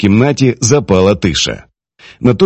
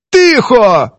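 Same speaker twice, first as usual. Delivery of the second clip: high, abrupt command; curt high-pitched order to hush; sharp cut-off; 13 semitones higher, 3 dB louder than usual. A narrator's reading. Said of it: "різко обірвала його Прохорова".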